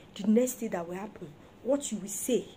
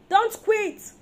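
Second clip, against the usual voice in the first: high, loud command; urgent cry; 9 semitones higher, 9 dB louder than usual. Real, not acted. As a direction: shout it to the crowd. Preacher exhorting the congregation.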